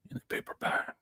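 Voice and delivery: quietly confiding; whisper. Real, not acted. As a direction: hush it like a secret. Whispered conspiratorial.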